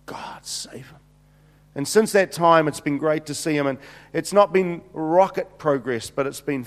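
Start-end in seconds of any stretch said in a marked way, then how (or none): 0.1-1.0 whispered, much softer prayer. Retold or imitated. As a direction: breathe the plea to God. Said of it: "just saying".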